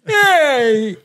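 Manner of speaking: high-pitched try at a Brooklyn accent; half-hearted Brooklyn call; attempted Brooklyn accent. Shown as a demonstration. Co-host asked to do Brooklyn, high tentative Brooklyn accent. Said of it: "How do you do it with Brooklyn".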